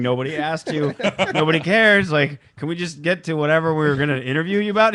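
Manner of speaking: a hint of an annoyed cameraman; mock exasperated cameraman. Cameraman telling ODB nobody asked, mildly exasperated.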